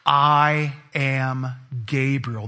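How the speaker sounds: slow, weighty, emphatic declaration; thunderous angelic proclamation. Shown as a demonstration. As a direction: boom it out like an angel.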